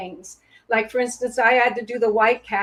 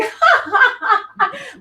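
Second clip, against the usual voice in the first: high, loud teasing ha ha; mocking laugh; 12 semitones higher, 5 dB louder, slower than usual. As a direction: laugh at someone stuck on the floor. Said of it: "looking at me on the ground going".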